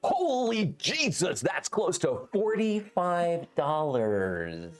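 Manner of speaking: slow, hyped announcer read; fighting game announcer. Pretend. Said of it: "I'm just gonna say it in a really cool announcer voice".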